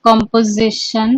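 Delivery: each word spaced out for notes; teacher dictating notes; slow dictation. Shown as a demonstration. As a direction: dictate it slowly so students can write. Teacher dictates the list slowly.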